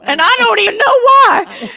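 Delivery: high, loud exasperated wail; hammed-up baffled squeal; comic bewilderment. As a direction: raise it high in mock bewilderment. Comic retelling of thanking the tester.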